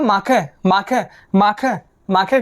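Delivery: माखै said slowly as maa-khai with a throaty ख; German pronunciation demo. Shown as a demonstration. Teacher modelling माखै for the class, slow and clear. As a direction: repeat माखै slowly, stressing the ख sound. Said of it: "कहा जाएगा".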